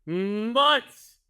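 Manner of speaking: emphatic exclamation. Real, not acted. Host exclaiming to guest about the hidden cannolis. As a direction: punch Months! as disbelief.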